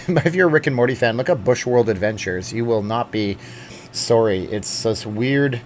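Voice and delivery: usual speaking voice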